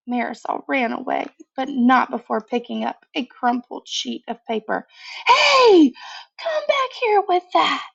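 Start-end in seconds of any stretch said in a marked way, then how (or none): 5.3-7.9 high, loud call after her. A narrator's reading. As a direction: shout to stop her running off. Ramon chases his sister.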